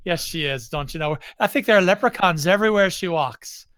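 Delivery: hammed-up lilting Irish brogue; Irish accent. Joking about a listener living in England, mock Irish accent. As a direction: play up a stage Irish accent. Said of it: "I'm just good at Irish accents".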